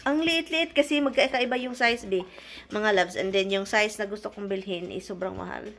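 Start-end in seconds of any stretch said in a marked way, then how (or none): 0.1-0.7 lightly voiced scoffing remark, raised pitch; a hint of Tami turning up her nose; imagined Tami's reaction. Tami mocking the small luggage, mildly voiced. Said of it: "masasabi niyang".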